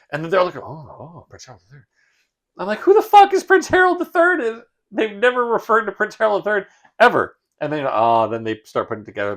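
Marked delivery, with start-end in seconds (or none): 0.6-1.9 very soft, awed murmur; barely audible mock reverence; hushed recognition. Mimics characters' reverent recognition. 2.8-7.4 very high, squeaky incredulous rant; comic indignant outburst; exasperated disbelief. Retold or imitated. Exasperated reaction to unexplained character.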